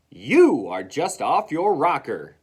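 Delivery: loud, slow, punched words; combative retort. Acted. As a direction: lean hard on each word.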